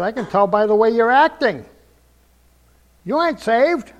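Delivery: mock accusing.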